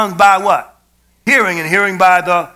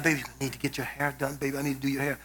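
quick, much softer, murmured to his sick wife; soft tender murmur; 7 semitones lower, 16 dB quieter, faster than usual. Retold or imitated. Husband murmuring to his bedridden wife about her hair, soft and tender. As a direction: coo it gently to her, softly and fast.

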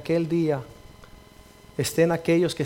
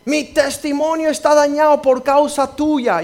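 accusing man; 10 semitones higher, 7 dB louder, slower than usual. Retold or imitated. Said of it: "Un hombre me dijo una vez".

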